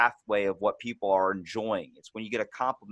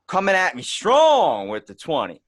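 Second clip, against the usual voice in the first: mock exclaim; 6 semitones higher, 8 dB louder than usual. Mocking reply to rude guest.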